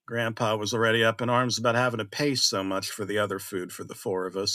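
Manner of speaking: plain reading voice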